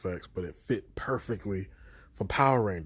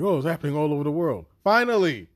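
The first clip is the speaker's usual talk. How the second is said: high, loud, rushed reaction breaking into an emphatic shout; mock fan outburst, louder and higher to the end; excited relief; 8 semitones higher, 8 dB louder, faster than usual. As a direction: act out an overjoyed "at last". Acted reaction to long-awaited acknowledgement.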